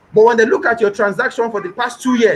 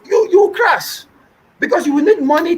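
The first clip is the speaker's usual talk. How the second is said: high pitched scolding; 7 semitones higher than usual. Real, not acted. Mocking the failed importer.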